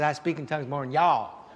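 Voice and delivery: put-on a light Texan accent on y'all; Texan drawl. Preacher joking that Paul was Texan, light Texan accent. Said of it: "Paul was Texan".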